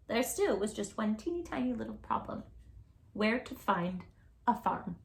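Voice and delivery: plain reading voice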